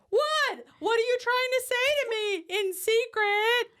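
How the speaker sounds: put-on high, squeaky kid voice. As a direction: pitch up like a confused kid. Kid's quote in retold scene.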